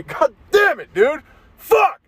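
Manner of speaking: exasperated outburst rising high; real frustration. Real, not acted. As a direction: pitch flying up in exasperation. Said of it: "own frustration now".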